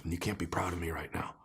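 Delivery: hushed, breathy father's confession under his breath; whispered confession. Retold or imitated. A dad pulls the car over and tells his son, whispered. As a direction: let it fall to a whisper.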